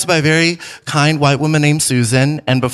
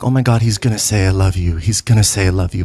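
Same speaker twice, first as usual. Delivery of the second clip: nervous inner voice; 7 semitones lower, faster than usual. Retold or imitated. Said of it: "I was so nervous 'cause I'm like".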